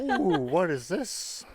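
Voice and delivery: high, loud, drawn-out mock intrigue; slow, exaggerated ooh of interest; intrigued male reader. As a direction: play a reader ogling a cover. Voices guys tempted by a cover.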